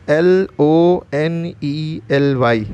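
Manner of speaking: slow letter-by-letter spelling. Shown as a demonstration. Teacher spells a new word for learners, slow spelling. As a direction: spell it slowly, each letter held apart.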